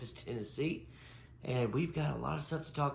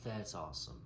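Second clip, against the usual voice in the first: usual speaking voice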